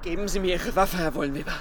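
calm, coaxing. Acted. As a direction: gently talk the armed man down. Ermittlerin will Waffe abnehmen. (leise und beruhigend)

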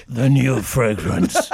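deep, slow mock voiceover; sultry perfume ad. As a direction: breathe it like a perfume slogan. Mocking perfume ad voice.

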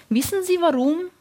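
high, hushed-feeling secret tease; conspiratorial question, pitched high. A narrator's reading. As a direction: let her lean in with a secret, pitch up. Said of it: "Ihre Stimme klang verschwörerisch".

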